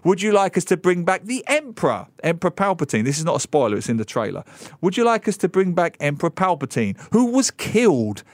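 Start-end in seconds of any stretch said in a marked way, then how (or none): 0.0-3.0 mock eager pitch. Mock studio asking fans. 4.8-8.2 mock eager pitch. Mock studio asking fans.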